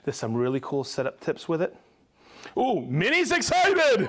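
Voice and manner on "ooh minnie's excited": high-pitched, swooping delight; lively high "ooh" of excitement; excited exclamation. Real, not acted. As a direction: cheer on a thrilled colleague. Reacting to colleague's excitement.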